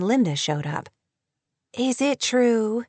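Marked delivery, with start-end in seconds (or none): 1.7-2.8 slow, drawn-out demand; mildly insistent, drawn-out question. A narrator's reading. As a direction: stretch the question, pressing for an answer. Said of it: "she demanded".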